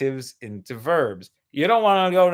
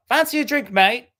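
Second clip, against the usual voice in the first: put-on light British accent offering "Fancy a drink, mate?"; breezy British pub invite, lightly done; 7 semitones higher, 4 dB louder than usual. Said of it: "English people saying".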